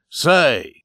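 loud, fierce outburst; crying out. Acted. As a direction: burst in with a sharp challenge. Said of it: "he cried as he threw the cards fiercely".

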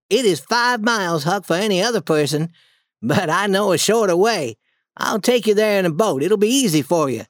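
put-on boy Tom, reassuring and eager. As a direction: Tom talks his sick friend into it. Tom reassures weak Huck.

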